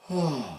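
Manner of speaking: soft low sigh from the pelvic floor; relaxed sigh demo; deep sigh. Shown as a demonstration. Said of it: "I'm gonna sigh on the perineum".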